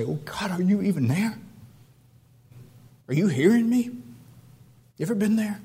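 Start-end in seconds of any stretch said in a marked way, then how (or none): 0.0-3.9 desperate question lifted to God; rising, anguished appeal; despairing. Acted. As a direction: plead upward, unsure anyone hears. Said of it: "in that depth of despair".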